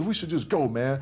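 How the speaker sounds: agitated, insistent mimicry; pissed, impatient. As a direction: imitate an antsy friend itching to leave. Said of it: "and he's pissed".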